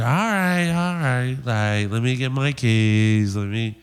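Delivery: put-on dawdling girl. Girl stalls while hunting for her keys, drawn-out mimicry. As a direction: mimic her stalling, stretching the words slowly.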